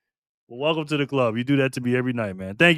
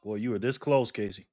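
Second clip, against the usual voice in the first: softer, lower disbelief; subdued near-miss remark; 6 semitones lower, 8 dB quieter than usual. Real, not acted. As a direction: say it low and subdued. First take on a near miss.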